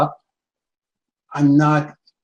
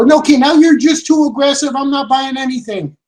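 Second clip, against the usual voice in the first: annoyed customer; 6 semitones higher, 4 dB louder than usual. Retold or imitated. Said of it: "if they go".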